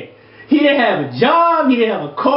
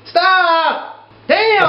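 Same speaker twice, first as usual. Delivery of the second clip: exasperated outburst; 9 semitones higher than usual. Real, not acted. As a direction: explode before catching yourself. Bursts out swearing at letter.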